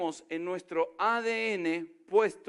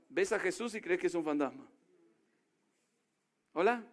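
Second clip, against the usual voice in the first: ordinary talk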